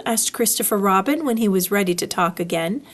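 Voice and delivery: plain reading voice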